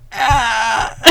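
high mock wail, breaking into laughter; mock wailing cry. Podcaster reacting to the awful scene, mock wail. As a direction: let out a playful cry of dismay.

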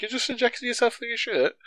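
high, soft incredulous question; mock disbelief. Son quoting his question to dad, mock incredulous.

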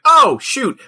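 high, loud "oh" of sudden realization; sudden exclamation. Acted. Fighter suddenly acts after being told to fight, exclaiming.

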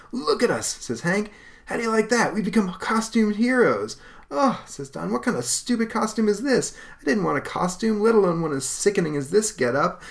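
crow, then sneer; young heroes. Acted. Brothers seeing their costumes, excited then disgusted.

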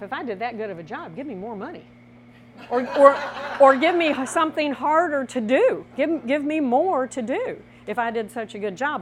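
firm left-brain insistence. Retold or imitated. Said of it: "he's saying to himself".

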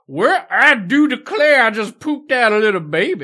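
hammed-up Southern lady wailing; Southern belle. Mocking the miscarriage scene.

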